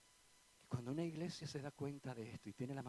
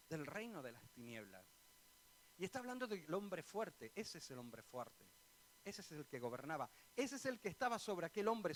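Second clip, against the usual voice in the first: ordinary talk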